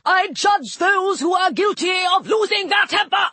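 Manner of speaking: high-pitched furious proclamation; indignant god losing his temper; angry shout. A narrator's reading. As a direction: rage it out, affronted and high. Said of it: "the god shouted angrily".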